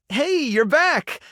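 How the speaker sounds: high, drawn-out happy welcome; delighted greeting. Acted. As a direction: greet them with a delighted lift. Greeting a returning helper.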